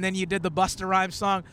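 ordinary talk, nothing marked

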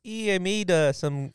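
slow, stretched Minnesota accent; mock Minnesota accent. Shown as a demonstration. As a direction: draw it out like a Minnesotan. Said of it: "Minnesota's like".

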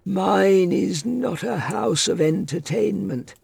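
put-on high, lofty old man's voice; haughty. A narrator's reading. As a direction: look down on the stranger. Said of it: "he said haughtily".

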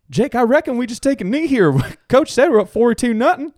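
very high-pitched teammate impression; Reggie Ray. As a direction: pitch it way up as a pleading football player. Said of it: "Reggie Ray comes on and he goes".

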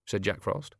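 ordinary delivery, nothing marked

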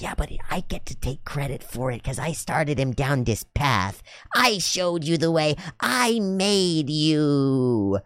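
mock-arrogant, drawn-out boasting; arrogant pride. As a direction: claim the credit with grand, stretched-out words. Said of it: "an arrogance and a pride tendency".

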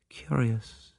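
a soft murmur of the single word; detective musing under his breath. Acted. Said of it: "murmured Poirot".

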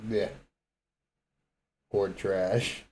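ordinary delivery

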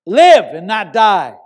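loud, drawn-out shout on LIVE; yelled command. Retold or imitated. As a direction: shout it slowly as a command over the dying. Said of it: "when I heard you yell at me".

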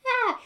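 sudden high piercing scream; demo of a blood-curdling scream. Shown as a demonstration. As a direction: let out one short piercing shriek. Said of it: "It's not just the".

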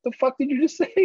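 high, incredulous snap at the husband; wife's retort. Retold or imitated. Wife reacting to husband's dumb remark, incredulous high snap. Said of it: "And Amanda's like".

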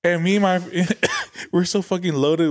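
usual speaking voice

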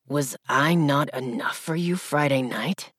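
a low male growl. Acted. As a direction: growl it close in her ear. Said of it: "growling deep in my ear".

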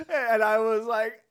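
loud high laugh into words; can't stop laughing at the memory. Real, not acted. Laughing while retelling.

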